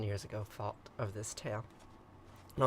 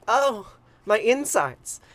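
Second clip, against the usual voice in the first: slow, loud drawn-out moan of pain; moaning sigh; 10 semitones higher, 9 dB louder, much slower than usual. Acted. Goddess in labor, a slow loud moaning cry. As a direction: let the goddess groan it out slowly.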